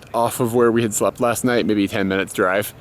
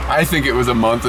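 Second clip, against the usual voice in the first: normal talking voice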